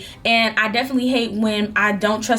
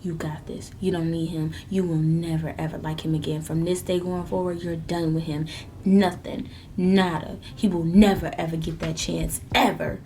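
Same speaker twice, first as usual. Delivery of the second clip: hushed, low self-encouragement; whispered pep talk; 4 semitones lower, 5 dB quieter than usual. Retold or imitated. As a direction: whisper it to yourself in the mirror.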